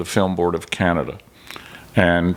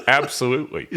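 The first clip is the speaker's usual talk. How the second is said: confident lie; 4 semitones higher than usual. Retold or imitated. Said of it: "lying through my teeth, not a moment of hesitation".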